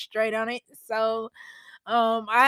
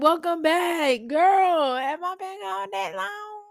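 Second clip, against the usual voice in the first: high-pitched voice for a sassy object; talking notepad; 8 semitones higher than usual. Acted. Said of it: "my notepad is like".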